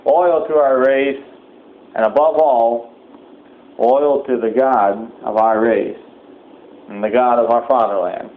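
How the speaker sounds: ordinary talk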